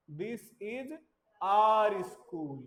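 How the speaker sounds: loud our for pupils; stress on our. Shown as a demonstration. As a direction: punch our. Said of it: "drill with stressed our".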